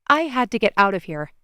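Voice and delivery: usual speaking voice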